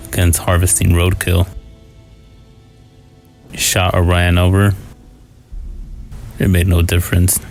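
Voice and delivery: ordinary delivery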